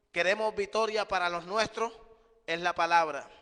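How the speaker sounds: loud, emphatic preacher's proclamation; full-force preaching. Acted. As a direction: hammer the message home to the congregation.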